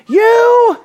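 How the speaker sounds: high, loud accusing cry; shouting high priest. Acted. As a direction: shout it at the accused, each word drawn out. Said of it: "now standing and shouting".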